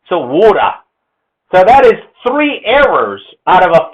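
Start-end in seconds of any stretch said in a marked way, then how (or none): 0.2-0.7 Australian "water" as waw-duh; water in an Australian accent; Australian pronunciation. Shown as a demonstration. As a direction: say "water" Australian-style, T as D, R dropped. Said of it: "the Australians decide".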